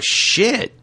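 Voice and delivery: sudden high-pitched curse at a near crash; startled curse. Retold or imitated. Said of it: "I was like".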